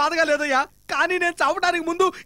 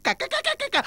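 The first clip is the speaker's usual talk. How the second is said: frightened stammer; 11 semitones higher, much slower than usual. Acted. Stammering in fear.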